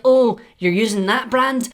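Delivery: mock slander. Imagined viewer slandering his mum's makeup, mock-judgy. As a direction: sneer at the brand as a snooty critic. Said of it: "I don't wanna see any slander being like".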